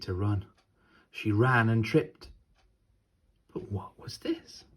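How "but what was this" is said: breathless suspense whisper. A narrator's reading. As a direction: lean in and whisper the question.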